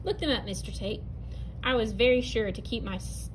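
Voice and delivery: ordinary delivery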